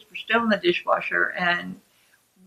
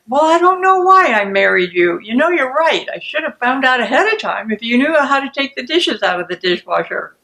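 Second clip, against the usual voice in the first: louder, higher marital comeback; angry retort; 4 semitones higher, 6 dB louder than usual. Acted. First person answers the marriage jab, angry. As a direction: throw the accusation straight back, heated. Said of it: "the first person responds to the content".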